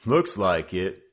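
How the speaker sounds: gang leader. Acted. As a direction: scoff at the lie. Gang leader sneers back.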